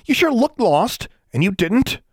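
high, emphatic comeback; bickering retort. A narrator's reading. Girl arguing with the boy over being lost, bickering. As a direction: needle him back in a high voice.

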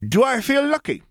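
mock tough-guy delivery; mock tough-guy line. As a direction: hit it like a famous movie line. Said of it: "The question is".

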